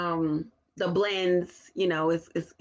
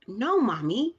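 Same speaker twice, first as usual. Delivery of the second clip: put-on child voice; 8 semitones higher than usual. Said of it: "I mean, like, really".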